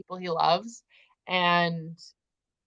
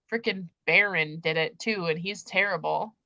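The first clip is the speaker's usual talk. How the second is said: usual speaking voice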